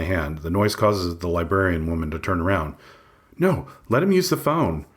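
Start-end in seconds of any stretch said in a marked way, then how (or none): 3.4-4.8 receptionist voice. A narrator's reading. Librarian woman intervenes.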